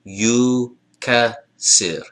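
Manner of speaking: Yukasir very slow, split yu, ka, sir; sounded-out vowels. Shown as a demonstration. Teacher showing the vowels, Yukasir drawn out slowly. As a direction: stretch Yukasir syllable by syllable from a long oo. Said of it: "The first one is always an oo".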